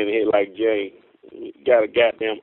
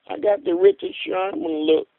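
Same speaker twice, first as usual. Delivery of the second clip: usual speaking voice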